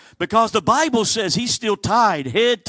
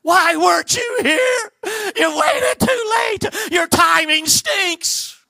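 high anguished shout, slowing at the end; Mary crying out at Jesus in grief and blame; why weren't you here, hurled up high and loud; emotional outburst; 3 dB louder, slower than usual. Acted. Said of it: "the more emotional one of the two".

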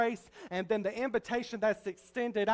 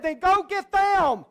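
loud, higher, slow "Then go get them"; commanding shout; 7 semitones higher, 7 dB louder, slower than usual. Retold or imitated. Master ordering his servant out, loud and commanding. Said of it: "He said".

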